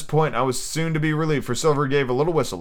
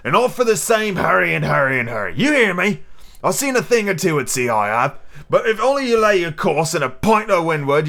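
put-on emphatic old buccaneer, pitched up; pirate ringleader, rebuking; 3 semitones higher, 4 dB louder than usual. Silver rebukes his hasty shipmates, scornful. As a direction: scold a reckless crew.

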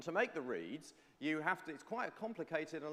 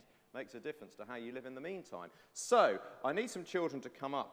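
ordinary delivery

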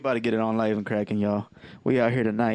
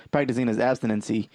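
ordinary delivery

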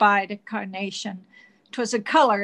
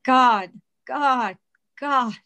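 crying out; 4 semitones higher, 4 dB louder, much slower than usual. Retold or imitated. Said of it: "Sir cried out".

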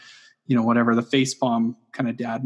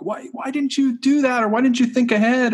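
high-pitched mock parental nagging; exasperated parent; 11 semitones higher than usual. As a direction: imitate yourself scolding the kids, pitched up. Acts out expecting too much of kids.

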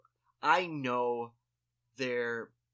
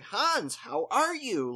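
high, loud hail to Hans; confident greeting; 10 semitones higher, 9 dB louder, much slower than usual. Retold or imitated. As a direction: imitate a big, sure-of-yourself hello. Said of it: "I was confident".

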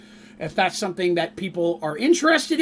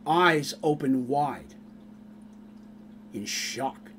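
quiet story narration; 2 semitones lower, 5 dB quieter than usual. A narrator's reading. Narrator telling the party's tale, hushed storytelling. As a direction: draw listeners into the scene softly.

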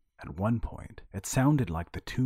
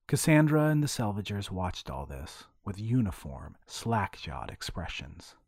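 ordinary delivery

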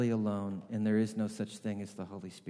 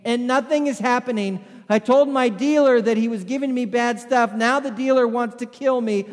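high, loud panicked rush with a laugh; baffled and freaking out; shouting in bewildered panic; frantic disbelief; 11 semitones higher, 11 dB louder than usual. Retold or imitated. Paul panicked, drugs not working.

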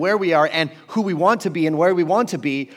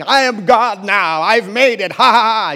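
hammed-up gloating, a bit louder; a lightly voiced crazed doctor cackling; playful mock mad-scientist boast; 5 semitones higher, 7 dB louder than usual. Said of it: "he's saying".

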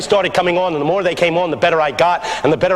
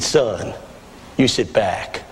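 put-on low, slow, confident old man; old pastor; 11 semitones lower, 4 dB quieter, slower than usual. Old pastor to young preacher beside him, low confident voice. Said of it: "the old man".

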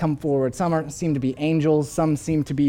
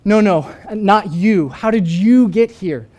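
higher and louder, pleading no, no; frantic refusal; 5 semitones higher, 7 dB louder than usual. Retold or imitated. Woman recoiling from her brother, frantic and loud. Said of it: "She says".

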